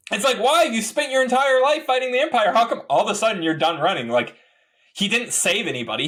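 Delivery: why on earth, exasperated; incredulous. Real, not acted. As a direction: fan ranting in disbelief at the character. Incredulous rant.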